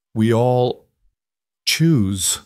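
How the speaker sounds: labored words with a long gap; dying, halting. A narrator's reading. Lucas dying, answering James, halting. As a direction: force out each word separately.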